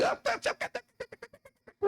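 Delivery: very high, fading impatient "tá, tá, tá"; mocking a bratty teen. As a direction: mimic a child brushing off a parent. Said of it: "Tem filho que". (imitando filho impaciente, bem agudo)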